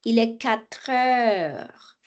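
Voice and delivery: "quatre heures" drawn out as "qua-treures"; linked consonant. Shown as a demonstration. Teacher repeats tr joining heure, slowly linked. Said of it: "Le tr va venir s'unir avec heure".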